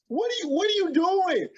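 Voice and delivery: hoog en luid, ongelovig; verbijsterde uitroep. Retold or imitated. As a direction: roep het verbijsterd uit. Baffled at friend's lamb chops.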